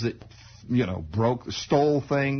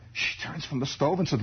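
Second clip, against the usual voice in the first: usual speaking voice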